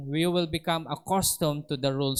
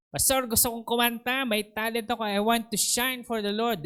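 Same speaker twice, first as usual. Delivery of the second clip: lightly voiced eager member, higher; a hint of a keen volunteer pleading to sing; eager church member; 7 semitones higher than usual.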